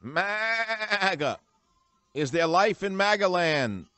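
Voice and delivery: loud, high, stretched-out hailing shout; town-crier bellow; calling out. Real, not acted. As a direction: holler it out, holding the long vowels. Calling out to rouse his audience.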